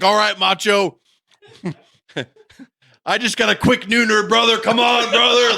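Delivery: loud, high, pumped-up shout; rally the partner with jokey gusto; mock hyped wrestler. Wrestler back on the apron to his partner, mock hype. Voicing jazzed-up Hulk at ringside.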